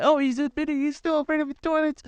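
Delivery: high-pitched teasing classmate voice; mocking accuser. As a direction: squeal it like a taunting twelve-year-old. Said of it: "You're gonna say".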